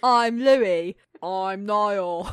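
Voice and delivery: put-on very loud boy-band caricature; hammed-up, drawn-out introductions; shitty YouTube parody voice. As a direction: announce yourself like a cheesy parody. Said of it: "you sound like that from".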